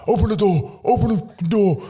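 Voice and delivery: high, mock demanding call. Senior player at the door at night, mock demanding. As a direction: hammer on the door with it.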